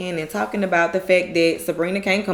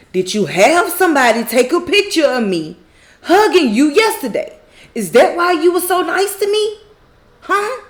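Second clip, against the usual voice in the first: higher, pressing mock interrogation; retold angry yell; 6 semitones higher, 3 dB louder than usual. Zach confronting Karen about a picture, retold aggressive yell. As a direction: climb in pitch to an accusing huh. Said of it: "Zach yells".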